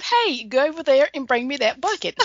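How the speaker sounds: mild Southern accent, smiling; Southern talk again. Shown as a demonstration. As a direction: say it again in the North Carolina way. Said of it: "I said".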